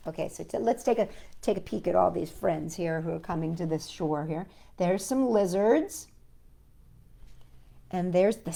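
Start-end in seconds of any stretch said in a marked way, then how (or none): none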